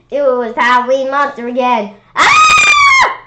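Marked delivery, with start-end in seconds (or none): 2.1-3.2 very high loud shriek; scream of fright. Acted. Kids seeing the monster, piercing scream. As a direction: shriek in terror. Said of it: "We all screamed".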